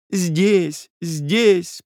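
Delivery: snatch the word up; Nastenka's high insistence; eager interjection. A narrator's reading. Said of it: "подхватила Настенька".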